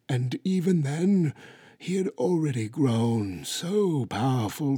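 put-on high, solemn old storyteller voice; ancient tortoise, gravely. A narrator's reading. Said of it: "she began gravely".

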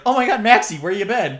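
high, loud acted astonishment; mock startled greeting. Acted startled greeting in retold film scene.

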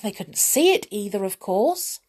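ordinary delivery

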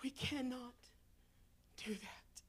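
starts low and drops to a soft whisper; fading to a whisper. Real, not acted. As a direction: let the words die away. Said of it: "fading to whisper".

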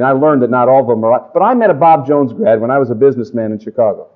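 ordinary talk

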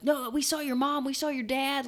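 mock accusing. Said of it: "They're like".

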